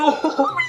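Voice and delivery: a very high squealing laugh of joy; shrieking laugh. Real, not acted. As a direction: squeal with delight as it arrives. Finally gets the T-Rex. (matinis na tawa sa tuwa)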